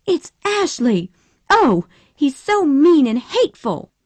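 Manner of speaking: tearful, indignant complaint to Melanie; upset cry. A narrator's reading. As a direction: Scarlett sobbing that Ashley is mean. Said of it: "cried Scarlett".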